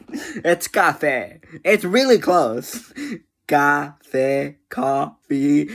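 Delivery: ordinary delivery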